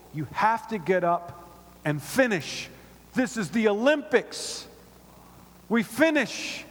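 slow start building to a high, emphatic finish; rally a fallen rival to get up; rousing urging. Acted. Runner urging her fallen rival to finish, insistent.

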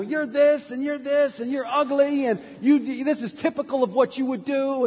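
high-pitched mock tirade, insults piling up; ranting parrot. Parrot still going at the woman from the freezer, mock ranting. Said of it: "the parrot's still going at it".

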